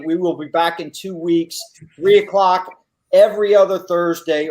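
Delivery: usual speaking voice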